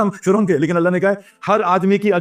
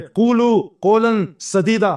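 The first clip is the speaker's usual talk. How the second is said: Quran recitation; 2 semitones higher, slower than usual. Acted.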